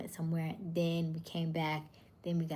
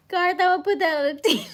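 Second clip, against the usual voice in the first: high, loud through laughter; cracking up at a viewer's joke, high and loud; laughing; 13 semitones higher, 14 dB louder, faster than usual. Real, not acted. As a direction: burst out laughing as you say it.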